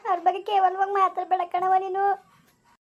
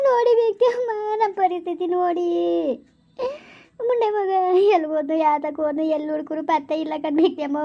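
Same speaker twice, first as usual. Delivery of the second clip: tearful wailing. Acted. Mother lamenting her daughter's fate to the in-law, tearful wail. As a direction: wail it out with sobs between phrases. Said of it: "ನೀವು ಕಣ್ಣೀರ್ ಹಾಕ್ಬೇಡಿ".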